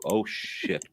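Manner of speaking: ordinary talk, nothing marked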